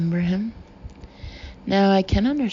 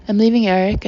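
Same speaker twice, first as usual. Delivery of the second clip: louder, a sudden burst of release; blurted confession, louder on leaving; 7 dB louder, faster than usual. A narrator's reading. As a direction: let it burst out of you. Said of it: "I blurted out like a release".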